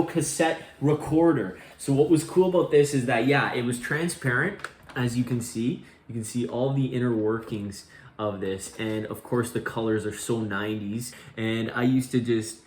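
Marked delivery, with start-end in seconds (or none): none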